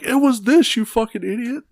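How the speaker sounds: high, mocking exasperated listener voice. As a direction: squeal it like fed-up listeners. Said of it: "everybody listening is like".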